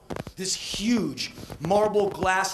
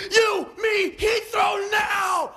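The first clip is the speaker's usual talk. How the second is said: high shouted order, last words dragged out slowly; frantic shout; much slower than usual. Retold or imitated. Tourist diving into the cab, yelling. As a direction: bark the destination in panic.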